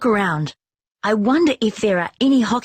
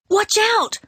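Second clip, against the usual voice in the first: much higher warning shout; warning call; 13 semitones higher than usual. A narrator's reading. Tom warns about the bursting water main. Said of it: "calls Tom".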